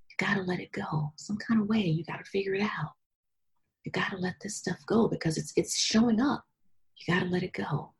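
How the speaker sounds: soft, half-whispered plea to yourself; hushed self-urging. Retold or imitated. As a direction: urge yourself quietly, as if thinking aloud. Retelling hushed talk to herself.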